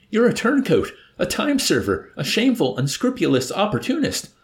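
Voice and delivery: high, loud incredulous accusation; outraged disbelief. A narrator's reading. Nately accuses the old turncoat, crying out in disbelief. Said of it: "Nately cried out in disbelief".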